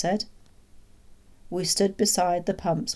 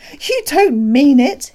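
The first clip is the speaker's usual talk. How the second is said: high, loud, drawn-out exclamation; astonished cry; 10 semitones higher, 14 dB louder, slower than usual. A narrator's reading. Doctor hooting at the whispered news, astonished cry. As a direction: cry out in delighted disbelief. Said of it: "he cried".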